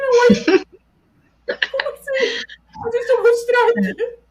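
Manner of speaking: high, loud shocked reaction; startled disbelief. Retold or imitated. Podcaster retells hearing about the strike from her co-host, startled disbelief. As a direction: react stunned, voice jumping way up. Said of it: "foi no susto".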